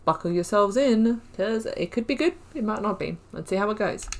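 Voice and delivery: ordinary delivery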